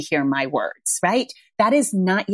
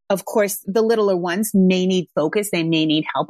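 ordinary talk

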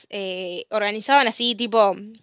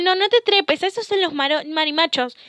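higher-pitched imitation of a fussing adult; mock scolding; 6 semitones higher than usual. Imitating relatives telling a girl not to climb trees, scolding. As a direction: scold a child in a put-on higher voice.

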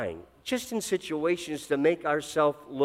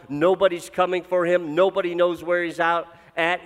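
raised, driving sermon voice; preaching at full force; 4 semitones higher, 4 dB louder than usual. Acted. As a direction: push the story, pressing louder and higher. Preaches climber story with force.

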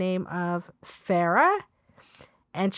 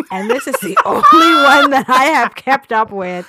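laughing; 4 semitones higher, 10 dB louder than usual. Real, not acted. Reading comment, laughing.

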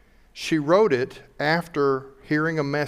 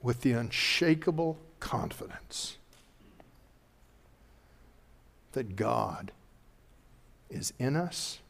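hushed preaching; 7 dB quieter than usual. Real, not acted. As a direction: draw them in, almost whispering. Quiet intimate sermon close.

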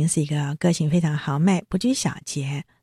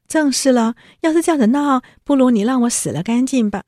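高声负气地抢白; 故意拧着话尖声顶回去; 赌气扭曲话; 7 semitones higher, 4 dB louder than usual. Retold or imitated. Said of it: "硬凹宝玉的话". (黛玉高声抢白宝玉)

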